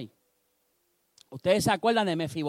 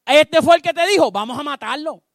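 high, loud angry outcry, easing at the end; furious accusation; 8 semitones higher, 10 dB louder than usual. Acted. Abisai to David about Simi: furious loud outcry. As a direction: point out the traitor and demand his death.

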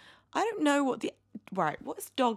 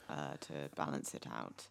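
low, soft flat drone; deliberately flat and much lower; monotone; 11 dB quieter than usual. Pretend. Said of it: "I'm gonna try and be more monotone".

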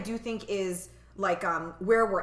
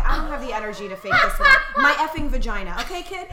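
louder, fast fed-up retelling; blunt exasperated mom; 4 semitones higher, 7 dB louder, faster than usual. Retold or imitated. Tired mom bluntly answering her son, louder and fast. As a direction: done with it, no energy to soften. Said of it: "she just was like".

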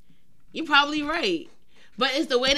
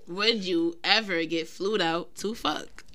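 slow, lower and softer "Would you ever get flued out to fuck"; slow repeat; 5 semitones lower, 5 dB quieter, slower than usual. Real, not acted. Repeating question for a confused listener, slow repeat.

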